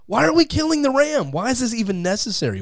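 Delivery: high-pitched incredulous questioning; exasperated protest. Acted. Atheist questioning the killing of the ram, high and exasperated. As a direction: protest way up high, easing down gradually. Voices his protest at the sacrifice.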